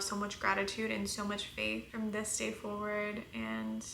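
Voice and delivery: soft, even praying voice; heartfelt prayer. Real, not acted. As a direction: pray gently over the listeners.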